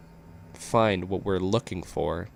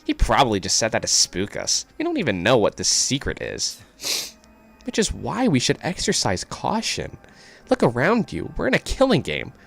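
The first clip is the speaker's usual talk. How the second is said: lightly raised worried voice; higher cautious character voice; 6 semitones higher than usual. Acted. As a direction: warn them, a touch higher.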